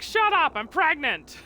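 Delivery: hammed-up offended yell, pitched high; loud, high protest in pretend outrage; indignant mock shout. Bao telling the teasing mermoos off, indignant shout. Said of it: "She's like".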